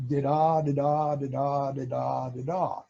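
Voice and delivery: voiced Morse rhythm. Shown as a demonstration. Showing how both paddles send alternating beeps, voiced Morse. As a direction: tap out each da-da like the keyer. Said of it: "and send".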